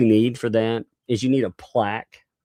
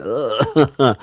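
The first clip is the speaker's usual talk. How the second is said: long, drawn-out scratchy-throat "ugh"; demonstrated itchy-throat noise; throat-clearing groan; 3 semitones higher, 5 dB louder than usual. Shown as a demonstration. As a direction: groan as if your throat itches. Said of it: "itching my throat".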